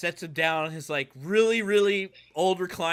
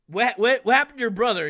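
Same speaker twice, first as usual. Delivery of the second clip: higher, louder urgent question; worried father; 8 semitones higher, 5 dB louder than usual. Acted. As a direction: ask anxiously about the lost child.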